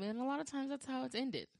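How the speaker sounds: ordinary talk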